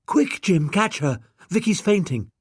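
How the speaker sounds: high, urgent call; urgent alarm. A narrator's reading. Daughter urging Jim to catch the fainting woman, alarmed. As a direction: shout for Jim to catch her.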